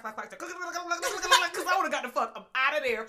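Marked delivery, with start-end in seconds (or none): 0.0-1.5 clacking heels. Retold or imitated. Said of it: "Them shoes would've went clack".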